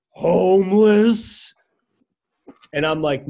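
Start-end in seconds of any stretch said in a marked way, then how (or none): 0.1-1.5 slow, louder, drawn-out question; mock the man stretching out the word; imitating the homeless man. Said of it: "He goes".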